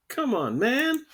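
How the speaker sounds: a hint of imitating the president; Biden catchphrase. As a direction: toss off his favorite line. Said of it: "That's his favorite line".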